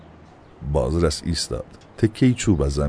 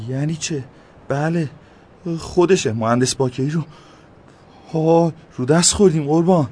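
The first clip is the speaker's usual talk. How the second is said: much higher, rising, getting louder; high excited alarm, louder at the end; alarmed realisation; 14 semitones higher, 4 dB louder than usual. A narrator's reading. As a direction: panic dawning as he recognises him. Recognises mayor, alarmed realisation.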